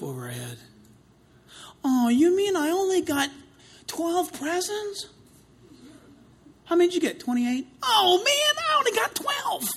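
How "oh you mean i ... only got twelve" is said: put-on whiny spoiled kid. Spoiled kids comparing Christmas presents.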